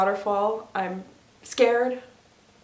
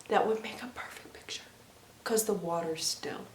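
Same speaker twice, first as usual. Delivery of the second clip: soft whisper trailing lower; hushed whisper; 3 semitones lower, 10 dB quieter than usual. Real, not acted. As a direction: drop to a breath for the still water. Hushed aside about perfect picture.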